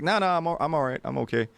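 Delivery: mock nervous friend declining; nervous refusal. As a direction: wave it off, uneasy. Brian voiced nervously declining.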